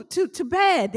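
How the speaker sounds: ordinary delivery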